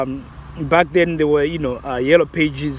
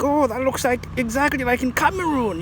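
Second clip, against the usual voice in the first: surprised recognition; 9 semitones higher than usual. Retold or imitated. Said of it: "someone might even think".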